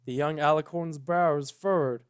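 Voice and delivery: usual speaking voice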